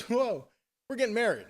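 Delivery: high, delighted king's outburst; retold excited announcement; mock amazement. King amazed at room of gold.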